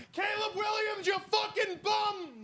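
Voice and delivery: mock yell at the TV. Pope yelling at the TV, strained high yell. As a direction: scream at the quarterback like a furious fan. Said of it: "He's yelling at the T V".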